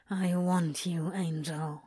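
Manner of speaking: low growl, falling away soft. A narrator's reading. Crowley to Aziraphale against his throat, growled. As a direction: growl it out, dropping on the last word. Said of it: "he growled against the skin".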